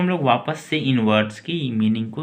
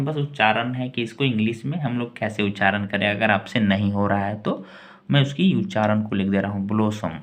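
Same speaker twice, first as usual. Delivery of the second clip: usual speaking voice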